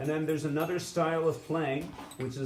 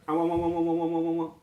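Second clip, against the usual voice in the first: sung wah wah, higher and louder; wavy sound imitation; 4 semitones higher, 3 dB louder, faster than usual. Shown as a demonstration. Teacher to students, imitating a wavy pulse. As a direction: mimic the monitor's wave out loud.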